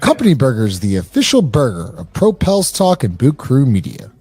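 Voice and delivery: loud, punchy sponsor read. Acted. Host pitching Company Burger to listeners, loud ad read. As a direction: sell it like a radio spot, loud.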